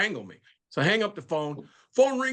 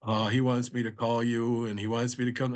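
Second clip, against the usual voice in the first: professor voice; 6 semitones lower than usual. Retold or imitated.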